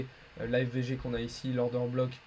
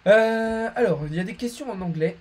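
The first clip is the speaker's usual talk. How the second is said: ordinary delivery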